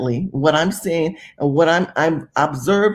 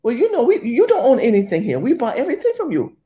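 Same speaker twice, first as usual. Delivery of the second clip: higher mocking voice, gloating; higher, quick gloating reply; smug new owner; 7 semitones higher, faster than usual. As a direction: rub it in that she owns nothing. New owners answer her.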